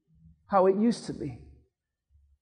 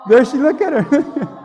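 laughing; 5 semitones higher, 8 dB louder than usual. Real, not acted. Laughing as mom cries.

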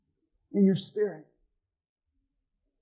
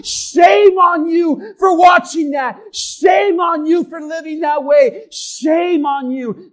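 accusation that builds to a loud, higher shout; devil's accusing voice; 3 semitones higher, 8 dB louder than usual. Acted. Pastor voicing the devil shaming a believer, rising shout. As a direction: hammer them with shame like the devil. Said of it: "That's how the devil works".

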